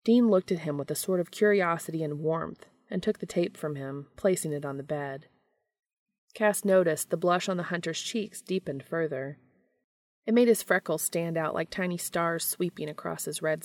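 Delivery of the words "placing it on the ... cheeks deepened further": ordinary delivery, nothing marked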